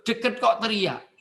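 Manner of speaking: ordinary talk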